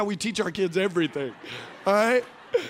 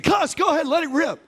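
eager urging; 6 semitones higher, 3 dB louder than usual. Retold or imitated. Preacher urging woman to cuss.

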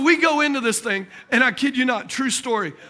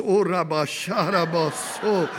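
low-pitched chanted prayer syllables, for laughs; mock speaking in tongues; 5 semitones lower, slower than usual. Trapped believer praying in the club, mock tongues. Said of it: "I'm speaking in tongues".

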